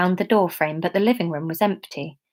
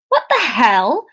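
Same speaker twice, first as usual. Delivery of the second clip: shouting; 4 semitones higher, 3 dB louder, slower than usual. Acted. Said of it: "she shouted into the silence".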